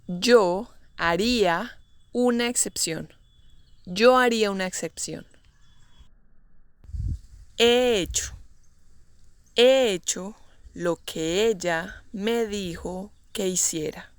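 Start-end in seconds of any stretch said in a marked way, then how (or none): 0.1-3.1 phrase by phrase, with pauses between. Shown as a demonstration. Teacher models example slowly, then naturally. 9.6-14.1 measured, broken into short chunks. Shown as a demonstration. Teacher models example slowly, then naturally.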